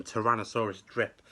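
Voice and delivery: plain reading voice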